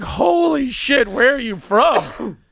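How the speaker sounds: high, slow, loud astonished cry; shocked shout. Retold or imitated. Customer stunned by the Irish accent, shocked shout. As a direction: blurt it out in disbelief.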